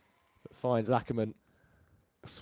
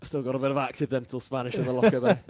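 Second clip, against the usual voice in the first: louder, higher, laughing through it; 3 semitones higher, 7 dB louder than usual. Real, not acted. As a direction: joke it out with a laugh. Laughing at own joke.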